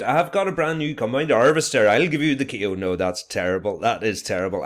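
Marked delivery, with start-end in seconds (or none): 0.0-2.6 loud, high mock West Country farmer voice; attempted West Country accent. Shown as a demonstration. Teacher badly imitating the Wurzels in a West Country accent. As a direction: ham up a West Country yokel singing the line. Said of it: "I am awful at accents".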